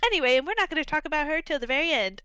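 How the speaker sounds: sarcastic imitation of the writers; mocking the writers. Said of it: "They were like".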